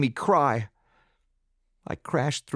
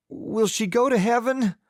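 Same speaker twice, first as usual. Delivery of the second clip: put-on child's plea; 11 semitones higher than usual. Boy asks as pig awaits slaughter.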